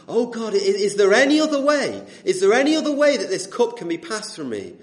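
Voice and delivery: loud, high, pleading cry to God. Retold or imitated. Preacher voices Jesus praying in Gethsemane, crying out. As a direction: call out in anguished prayer, retold. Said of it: "he's crying out".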